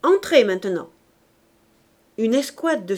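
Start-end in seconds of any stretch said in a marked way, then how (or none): 0.0-0.9 loud call to those behind; firm, louder order. A narrator's reading. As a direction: summon them in with a raised voice. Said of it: "appelant derrière lui".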